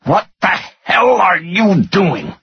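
high, drawn-out scream of fury; screamed outrage. A narrator's reading. Finance director bursts into his boss's doorway, screaming. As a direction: scream it in disbelief, stretching the words. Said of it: "the finance director screamed".

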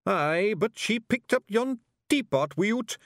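high, loud Scottish pictsie; a tiny rough Feegle butting in. Acted.

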